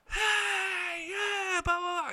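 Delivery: high, held-back mock holler; imitated calling back. Steve answers the fan from the field, imitated calling back. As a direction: answer in a high faraway call. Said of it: "And Steve goes".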